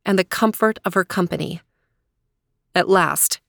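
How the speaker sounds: ordinary delivery, nothing marked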